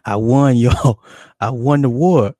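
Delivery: proud shout to the audience; triumphant boast. Real, not acted. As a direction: crow about winning.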